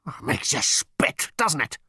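slow, rough, seething outburst; furious battle machine. Acted. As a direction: spit the words out in the machine's rage. Machine keeps raging in the story.

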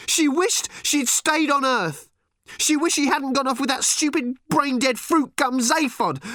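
high, screamed outburst with desperate pleading; furious tirade. A narrator's reading. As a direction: scream and plead like an overwrought child. Furious daughter's tirade at her father.

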